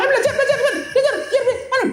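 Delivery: high, repeated scolding chant; nagging mother. Acted. Mother scolds her child to study, high nagging chant. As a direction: hammer the word at the child.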